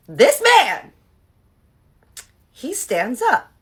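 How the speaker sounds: drawn-out, loud build-up, rising high; scandalized disbelief. Real, not acted. Storyteller to viewers before the proposal reveal, scandalized. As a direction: stretch each word for scandalized suspense.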